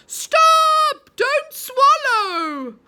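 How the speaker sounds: very high, loud, dragged-out shout; frantic yell. A narrator's reading. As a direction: scream at her to stop. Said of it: "yelled Moonface suddenly".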